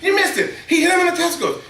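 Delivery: high, laughing insistence; excited insisting. Retold or imitated. Dad insisting to his son, high and laughing. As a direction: push it on him, half laughing.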